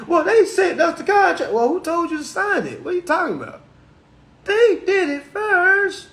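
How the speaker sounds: high whiny voice dodging the blame; childish excuse voice. Retold or imitated. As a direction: play the kid who won't own it.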